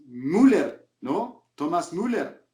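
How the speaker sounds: Müller said MOO-ler, plain u; Hispanicized pronunciation. Retold or imitated. Narrator quotes critics correcting him, Hispanicized pronunciation. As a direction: say Müller Spanish-style, "MOO-ler", r sounded. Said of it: "si es Müller".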